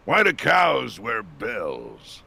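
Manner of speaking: slow, deadpan dad joke; slow joke delivery. Shown as a demonstration. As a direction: draw out the punchline slowly. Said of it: "The delivery".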